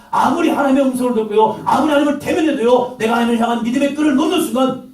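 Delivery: high, loud, building sermon cry; loud preaching climax; full-force preaching. Real, not acted. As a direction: preach it out hard to the congregation.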